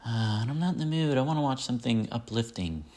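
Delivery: husband lightly mimics his wife turning down Reservoir Dogs; mimicking wife. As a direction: act out the wife begging off the movie. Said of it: "and she just".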